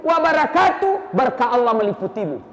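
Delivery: full earnest salam. Shown as a demonstration. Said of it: "sempurnakan salam kita".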